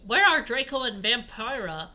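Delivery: ordinary talk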